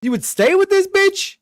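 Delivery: high, loud incredulous outburst; can't believe what he's hearing; shocked disbelief. Real, not acted. Outraged disbelief at caller.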